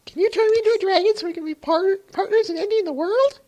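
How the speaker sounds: very high squeaky voice, fast; squeaky cartoon pony, pushed high; helium voice. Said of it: "keep that helium voice".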